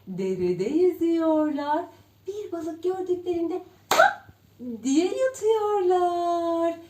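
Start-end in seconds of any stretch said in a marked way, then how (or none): 3.9-4.3 very high, loud snap of a gulp; gulping gasp. Pretend. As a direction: snap up the fish with a burst. Ördekler balığı yutuyor. (çok tiz, yüksek yutma sesi)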